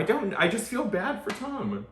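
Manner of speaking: high-pitched, worked-up defense; plaintive sympathy. Real, not acted. Sticking up for Tom to friends, plaintive sympathy. Pleading Tom's case to co-hosts.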